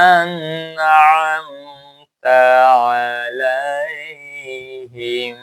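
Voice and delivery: slow melodic tajweed chant, every vowel drawn long; Quran recitation. Shown as a demonstration. Teacher reciting the verse for students, slow melodic chant. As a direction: chant the verse slowly like a qari. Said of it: "একটু বড় করে উচ্চারণ করবে".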